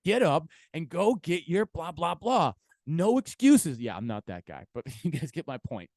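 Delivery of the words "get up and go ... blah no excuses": loud mock drill-sergeant hype; hype motivator. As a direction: bark it like a no-excuses coach. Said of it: "trying to be one of those people like".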